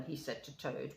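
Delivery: plain reading voice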